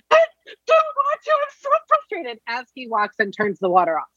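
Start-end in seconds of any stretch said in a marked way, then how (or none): 0.1-2.4 very high, hammed-up whining boy; whiny kid refusal. Mother imitating her son refusing to turn off the water, high whine. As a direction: whine it like a tired kid who's done.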